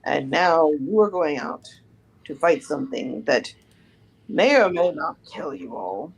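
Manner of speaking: put-on low, grave old mentor; old teacher voice. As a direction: speak as a weary old teacher.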